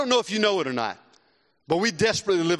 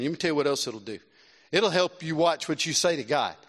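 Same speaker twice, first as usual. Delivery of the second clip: ordinary delivery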